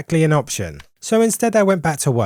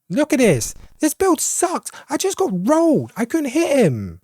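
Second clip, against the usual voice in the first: exasperated whine; 9 semitones higher than usual. Real, not acted. Frustrated high complaint.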